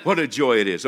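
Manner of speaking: normal talking voice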